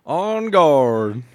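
mock alarm.

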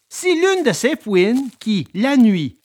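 ordinary delivery

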